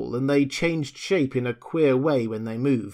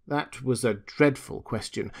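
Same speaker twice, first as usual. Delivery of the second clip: usual speaking voice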